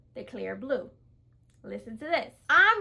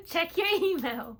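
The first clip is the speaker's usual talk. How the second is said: laughing; 5 semitones higher than usual. Retold or imitated.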